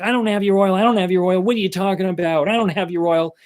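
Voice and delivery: high-pitched mock protest; acted protesting denial. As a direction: play the accused man insisting he's innocent. Accused thief's denial acted out.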